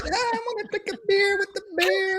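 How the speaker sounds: put-on high, laughing New York deli-worker accent; hammed-up New York accent through a laugh. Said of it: "Sound like you work in a deli in New York".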